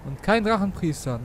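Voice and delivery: ordinary talk, nothing marked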